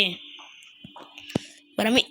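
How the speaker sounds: slow whisper. Real, not acted. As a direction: whisper it sneakily while jumping. Whispered move.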